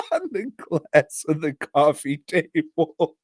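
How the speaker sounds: helplessly amused, words broken by laughs; talking through laughter. Real, not acted. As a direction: struggle to tell it while cracking up. Laughing through grisly plot summary.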